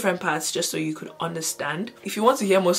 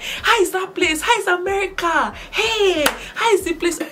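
very high, eager squealed questions; mock excited friends; 13 semitones higher, 4 dB louder than usual. Friends phoning to ask about America, mock high-pitched excitement. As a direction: imitate friends bursting with curiosity.

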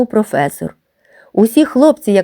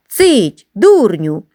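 mock shout; much slower than usual. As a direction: hush him with a teasing yell. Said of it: "кричали на нього сміхованці хлопці".

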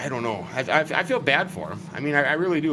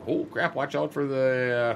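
usual speaking voice

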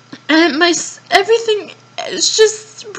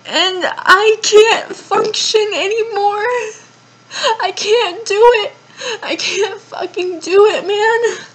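sobbing out that she can't cope; crying with a wet half laugh; 2 semitones higher than usual. Real, not acted. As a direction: voice breaking into real tears.

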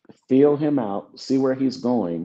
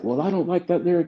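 high-pitched imitation of a quibbler; mock objection; 9 semitones higher than usual. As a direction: act out petty disagreement in a raised pitch. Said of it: "We don't butt heads like".

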